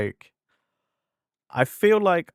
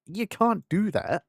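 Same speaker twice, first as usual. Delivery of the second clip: retold protest; 7 semitones higher than usual. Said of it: "I was like".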